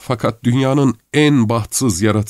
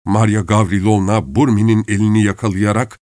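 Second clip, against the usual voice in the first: usual speaking voice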